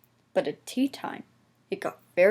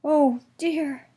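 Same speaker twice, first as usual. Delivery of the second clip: sad; 6 semitones higher, 4 dB louder than usual. A narrator's reading. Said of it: "thought Percy sadly".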